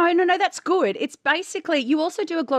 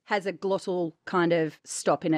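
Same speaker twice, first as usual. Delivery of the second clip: usual speaking voice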